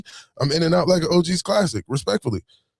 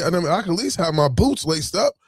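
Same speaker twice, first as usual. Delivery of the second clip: ordinary delivery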